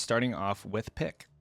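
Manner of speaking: ordinary talk, nothing marked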